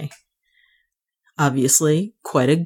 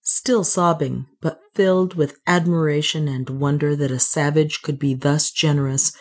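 ordinary delivery, nothing marked

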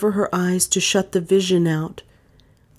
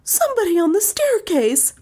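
high, distraught voice; high-pitched frantic witness; wild. A narrator's reading. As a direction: blurt it wildly, pitched way up. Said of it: "she said wildly".

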